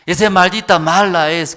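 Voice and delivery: mock-furious parent shouting; angry parent. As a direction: spit out the blame like a furious mother. Parent curses the girl.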